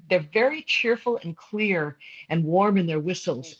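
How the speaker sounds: ordinary delivery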